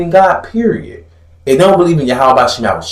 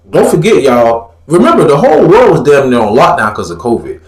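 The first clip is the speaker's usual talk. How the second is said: normal talking voice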